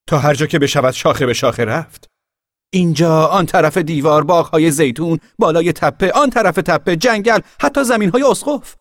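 very high, loud, building listing; excited boasting. A narrator's reading. Boy listing his treetop realm to the girl, excited. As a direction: sweep it out grandly.

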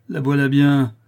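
father bursting out in despair; bitter outburst. A narrator's reading. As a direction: throw the words out in anguish. Morel raving, repeats phrase.

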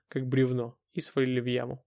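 usual speaking voice

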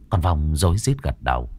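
plain reading voice

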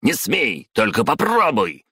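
high-pitched angry warning; threatening cry. A narrator's reading. Trying to stop colleague leaving.

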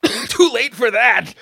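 high, slow, strained wheeze; wheezing. A narrator's reading. Pepper-sprayed officer answers his chief, wheezing. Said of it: "Jesse wheezed".

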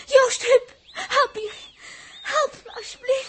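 loud crying cries for help; frightened plea. Acted. Els begs Joost and Huub for help, crying out. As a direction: beg them, close to tears.

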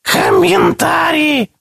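very high shrill creature shout; whistling creature. A narrator's reading. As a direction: squeal it like a greedy beast. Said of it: "просвистел другой".

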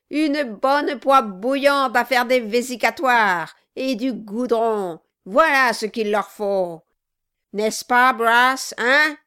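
loud, relishing villain's list; crying out. A narrator's reading. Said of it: "cria le nain".

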